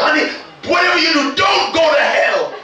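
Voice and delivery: a hammed-up yell of alarm; mock yell. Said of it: "looked at his wife and said".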